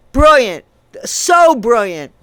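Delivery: loud, slow sarcastic brilliant; loud sarcasm. Real, not acted. Mocking Hollywood's choice, loud slow sarcasm. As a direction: drip the word with scorn.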